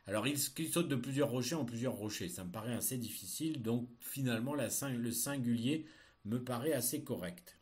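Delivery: ordinary delivery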